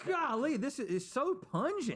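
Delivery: high, loud disgusted cry; disgusted outburst. Real, not acted. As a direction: recoil from a pungent smell. Reacting to the stink.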